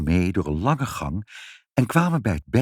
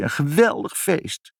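put-on crying child; 9 semitones higher, 4 dB louder than usual. A narrator's reading. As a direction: wail ever more miserably like a child. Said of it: "huilde Ibbeltje". (huilend, steeds harder en langgerekter jammerend)

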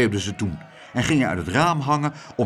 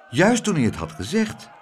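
usual speaking voice

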